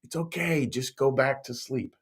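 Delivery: imitated tender parent, softened voice; loving parent. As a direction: gently settle a child after a bad dream. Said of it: "parents say that with a lot of love".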